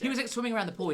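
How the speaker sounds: ordinary talk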